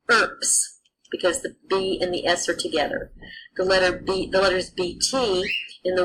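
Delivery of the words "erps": "erps" drawn out slowly, B heard as P; sounding out a word. Shown as a demonstration. Latin teacher modelling urbs as erps for students, slow. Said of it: "sounds like".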